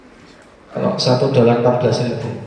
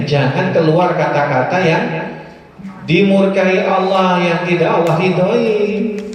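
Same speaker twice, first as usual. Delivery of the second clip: normal talking voice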